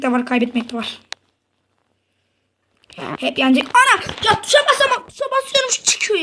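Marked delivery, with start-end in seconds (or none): 3.6-6.2 high-pitched panicked complaint; frustrated high yelp. Real, not acted. Button not working.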